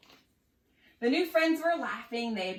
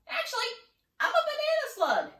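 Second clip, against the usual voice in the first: much higher, lightly silly voice; slug character exclaiming; 4 dB louder than usual. A narrator's reading. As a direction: chirp it out as a slug. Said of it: "the stranger exclaimed".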